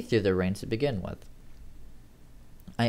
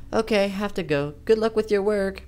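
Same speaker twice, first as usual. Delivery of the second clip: Amy's voice; 10 semitones higher, 5 dB louder than usual. Acted. Narrator voices Amy on phone.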